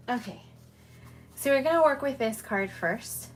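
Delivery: puffing after the stairs; out of breath. Real, not acted. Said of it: "I am now out of breath".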